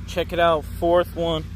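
normal talking voice

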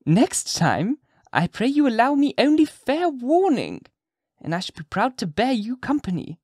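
put-on high, sly old witch voice; witch lady. A narrator's reading. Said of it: "observed the witch lady".

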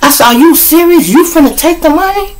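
high incredulous "Are you serious?"; indignant disbelief. Retold or imitated. Confronting his partner about the money, high incredulous. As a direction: challenge him, pitch up high. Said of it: "I'm like".

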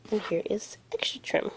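hushed whisper of the repeat. Real, not acted. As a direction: breathe it out as a secret aside.

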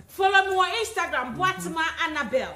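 excited promo. Real, not acted. Plugging her social media accounts.

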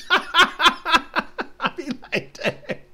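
high squealing laugh, very slow. Real, not acted. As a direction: burst out laughing mid-sentence. Laughing at own act.

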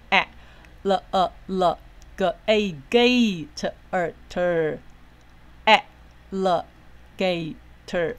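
teacher blending "alligator" slowly, before adding stress; syllable-by-syllable sounding out. Shown as a demonstration. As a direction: sound out "a, l, gator" piece by piece with pauses. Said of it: "我们来念念看".

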